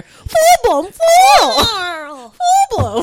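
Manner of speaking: very high, very loud yell; sudden blast. Shown as a demonstration. Friend demonstrating a jump-scare podcast, sudden silly scream. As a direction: burst out of nowhere screaming. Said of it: "And then all of a sudden, out of nowhere".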